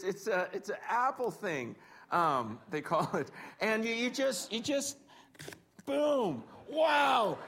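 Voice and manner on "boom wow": excited wow. Real, not acted. Apple slicer gadget demo.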